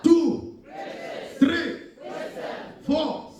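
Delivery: rallying shout. Real, not acted. Preacher wants church to count along, rallying shout. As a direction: call each number out for the crowd to echo.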